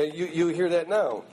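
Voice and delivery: usual speaking voice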